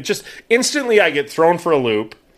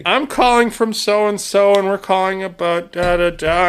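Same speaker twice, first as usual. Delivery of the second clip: slow, higher recited phone script; imitated caller; 4 semitones higher than usual. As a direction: drone through the caller's script slowly. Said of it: "she's just like".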